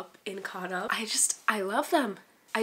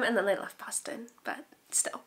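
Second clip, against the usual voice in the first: normal talking voice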